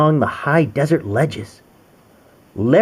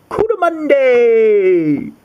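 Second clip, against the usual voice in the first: high, very slow Cootamunde; stretched, ringing Cootamunde; drawn-out exclamation; 9 semitones higher, 4 dB louder, much slower than usual. Acted. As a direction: announce it with wonder. Naming creature dramatically.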